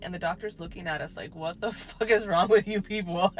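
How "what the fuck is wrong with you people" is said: laughing disbelief. Retold or imitated. Doctor stares at laughing family.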